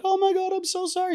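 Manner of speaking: very high, fluttery apology; flustered Balinese mom. Retold or imitated. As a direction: squeal it like a panicked mother. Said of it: "and she's like".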